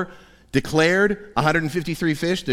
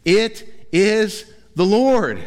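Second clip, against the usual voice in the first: slow, high, louder awe; declaration; 7 semitones higher, 4 dB louder, much slower than usual. Retold or imitated. John recognising Jesus from the boat, slow awed declaration. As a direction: proclaim it slowly in wonder. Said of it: "John makes the declaration".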